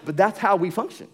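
ordinary talk

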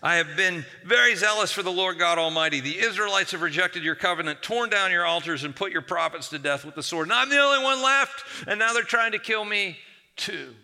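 high, loud, whining grievance again; loud complaining wail; repeated whiny complaint. Acted. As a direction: whine to God as before, full force. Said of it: "Elijah repeats the same thing".

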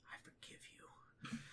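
slow, barely audible whisper; hushed, emotional whisper; whispered forgiveness. Acted. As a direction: breathe it out very softly, slowly. Orc forgives, about to weep.

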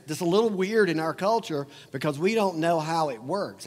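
ordinary delivery

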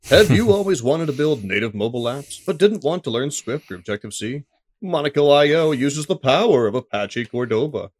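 infomercial voice. Shown as a demonstration. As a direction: sell it like a TV infomercial. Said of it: "It sounds like an infomercial".

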